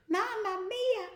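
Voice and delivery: very high, slow hammed-up Mamma mia; comic Italian cry. Soldier blasted in the bottom, hammed-up Italian yelp.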